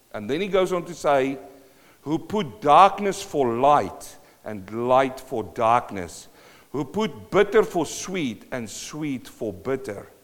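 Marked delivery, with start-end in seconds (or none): 2.0-10.1 slow reading. Shown as a demonstration. Reading verse slowly.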